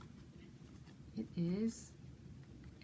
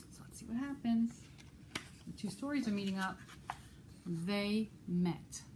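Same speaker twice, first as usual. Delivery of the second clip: put-on bright read-aloud voice for kids; gentle storybook narration; storytime reading. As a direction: read it to a circle of children. Reads picture book to children.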